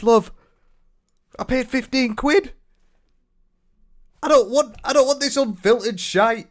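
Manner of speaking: high-pitched, loud and exasperated; indignant rant. Real, not acted. Buyer ranting about a paid birthday cameo, indignant.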